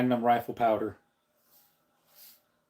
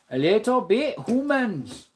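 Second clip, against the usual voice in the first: put-on high, slow Scottish-accented ogre voice; Shrek; 7 semitones higher than usual. Streamer imitating an ogre for the livestream, high slow Scottish accent. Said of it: "He has a Scottish accent when he talks".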